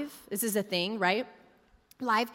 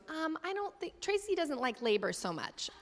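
much softer, hesitant nurse voice; hushed nurse; 6 semitones higher, 9 dB quieter than usual. Retold or imitated. Nurse quietly telling the doctor the mom hates labor.

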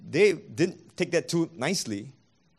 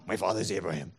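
lower, softer smug My father is Abraham; mock stock excuse; 9 semitones lower, 5 dB quieter, faster than usual. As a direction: toss off the excuse as if it ends the matter. Mocking their stock excuse.

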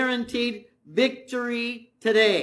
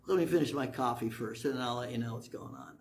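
lower, softer, unbothered; chill; 10 semitones lower, 9 dB quieter, faster than usual. Retold or imitated. Elisha calmly telling his frightened servant to wait, chill. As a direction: lazily wave off the panic. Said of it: "He's chill".

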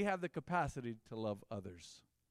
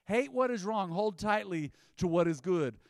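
high and loud, proclaiming the verse; preaching; 4 semitones higher, 7 dB louder than usual. Real, not acted. Preacher repeating scripture to the church, loud and high. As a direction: drive the verse home from the pulpit.

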